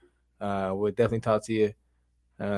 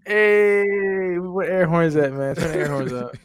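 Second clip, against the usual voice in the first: excited shout; 7 semitones higher, 4 dB louder, slower than usual. Real, not acted. Celebrating the news.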